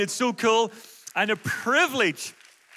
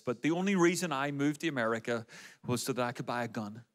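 ordinary talk, nothing marked

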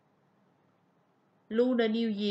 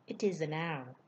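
usual speaking voice

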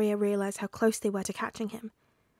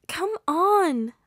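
high, loud, pleading whine; whining; 8 semitones higher, 7 dB louder than usual. A narrator's reading. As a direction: whine at gloomy friends. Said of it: "Ashida whined".

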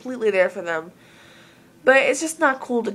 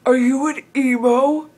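a hint of a gruff old stranger confronting a kid; grumpy old man; 4 semitones higher, 4 dB louder than usual. Grumpy old man stopping a teen on the street, lightly voiced. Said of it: "this grumpy old man".

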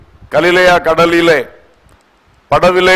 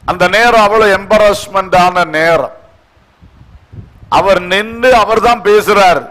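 normal talking voice

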